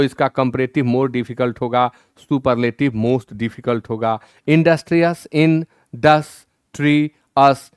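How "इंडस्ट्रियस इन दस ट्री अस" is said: Industrious split as इन, दस, tree, अस, each part said separately and slowly; syllable by syllable. Shown as a demonstration. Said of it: "यह चार syllable में है". (Industrious को शब्दांशों में तोड़कर धीरे-धीरे बोलना)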